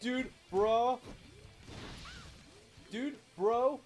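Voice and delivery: a bad Brooklyn wrestling promoter hyping the match; terrible Brooklyn accent. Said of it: "That's my terrible Brooklyn accent".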